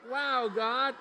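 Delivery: excited call. Pretend. Pastor leads kids in the cheer, excited. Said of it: "That's why we say".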